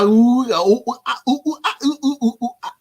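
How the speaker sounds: high silly oohs and ahs standing in for a name; mock name mumble. Vlogger mocking a name he can't pronounce, high silly oohs. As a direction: fumble the name with goofy high ooh-ah noises.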